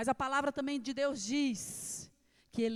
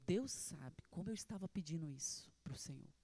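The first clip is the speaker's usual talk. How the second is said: soft, lower whisper; tearful whisper; 8 semitones lower, 9 dB quieter than usual. Retold or imitated. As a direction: whisper her thanks, much softer. Woman crying, thanking God. (sussurro emocionado e baixo)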